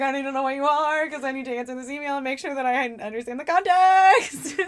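high and loud, pleading; begging the word to explain itself; mock frantic. Retold talking to the word.

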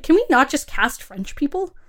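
exasperated rant. Retold or imitated. Griping to cohost about accent casting, exasperated. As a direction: throw up your hands at the casting. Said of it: "And I'm like".

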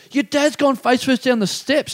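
high mock-urgent announcement; urgent alert. Someone alerting the son.

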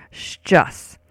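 "Szczęs" as one detached syllable, shchus; syllable demo. Shown as a demonstration. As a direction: repeat "Szczęs" for the learner, shch plus us. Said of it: "Once more".

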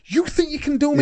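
high-pitched indignant challenge; retold rising anger. Boxer sizing up his challengers, angrily indignant. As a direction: re-enact getting riled up. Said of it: "Now I'm getting really angry".

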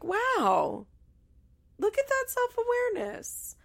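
very high-pitched wowed reaction; mock amazed. Reacting to her surprising insight, exaggerated amazement.

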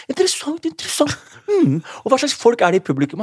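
high, fake-fascinated interessant; mock fascinated. Comedian to organizer, pretending to be fascinated, high and mocking. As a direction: play up the polite interest, very high. Feigning interest at the gig briefing.

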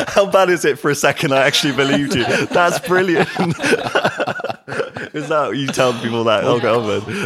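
high, loud laughing disbelief. Real, not acted. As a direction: crack up at being fooled. Laughs at being fooled.

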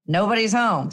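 usual speaking voice

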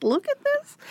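very high squeaky mock exasperation; squeaky exasperated. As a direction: squeak it out in disbelief.

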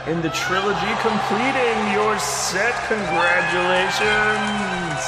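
lofty ceremonial announcer voice; grand announcer. Acted. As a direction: present the award like a showman. Commissioner presents badge.

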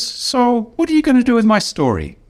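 high, pressing quoted question; lightly mimicked insistent boss; voicing Ron demanding. Ron repeats his demand, higher.

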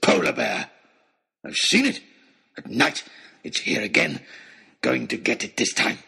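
rough, harsh half-mad voice; wild hunter. A narrator's reading. Oxshott tells the crowd about the bear, wildly. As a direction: rant in bursts like an obsessed hunter.

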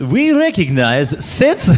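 put-on slow heavy Russian accent; thick Russian accent, slow and loud; Marise Payne in Russian accent. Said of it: "saying this in a Russian accent".

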